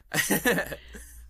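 short high burst of laughter; real laugh. Real, not acted. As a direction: laugh it off lightly. Laughs at own remark.

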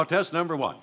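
normal talking voice

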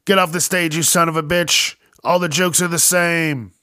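rowdy heckler yelling at comic; loud drunk heckling. Acted. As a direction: boo him off the stage.

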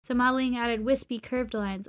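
usual speaking voice

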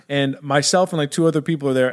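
ordinary delivery

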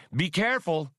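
a shrill-pitched anxious bleat; squawked. Acted. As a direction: squawk the warning at the pilot. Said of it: "squawked the skip tracer".

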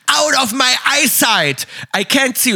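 high, slow sing-song mockery of his rant; mocking the yelling owner. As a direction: parrot the shop owner's outburst, hammed up and sung out. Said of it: "schreit uns wieder an".

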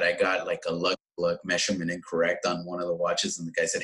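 ordinary talk, nothing marked

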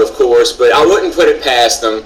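normal talking voice

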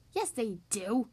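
ordinary delivery, nothing marked

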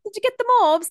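high loud teasing question; playful excitement. Acted. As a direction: tease it out gleefully. Playful repeat, laughing.

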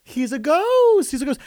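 mock-spooky announcement; dramatic reveal. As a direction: drag it out like a spooky secret. Comic reveal of ghost husband.

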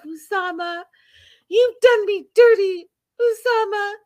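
very high, hammed-up outrage; Debbie impression. Acting out a woman telling Osama off, high mock outrage. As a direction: scold him in a high, wronged woman's voice. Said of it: "she says".

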